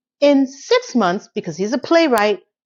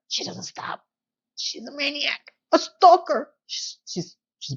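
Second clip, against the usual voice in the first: hushed exasperation; 3 semitones higher, 6 dB quieter than usual. Real, not acted. Ranting about Carrie the stalker.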